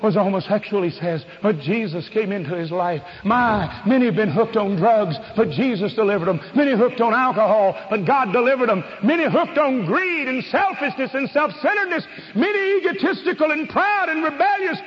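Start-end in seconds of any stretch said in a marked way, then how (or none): 3.2-14.8 preaching that climbs to a high-pitched peak; rising revival fervor, pitch soaring at the end; impassioned preaching. Real, not acted. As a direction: build each phrase higher, like a revival preacher. Preacher's list building to a climax.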